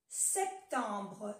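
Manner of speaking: ordinary talk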